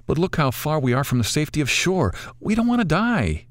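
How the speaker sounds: a scared woman begging, pitch climbing; frightened Jennifer. Acted.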